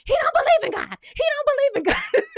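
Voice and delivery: high, loud shriek of disbelief, laughing through it; screaming. Retold or imitated. Recapper reliving the reaction to a quote, screaming. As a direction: scream it out, far up in pitch. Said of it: "I was literally screaming".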